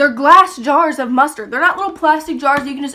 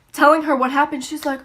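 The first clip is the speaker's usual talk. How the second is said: usual speaking voice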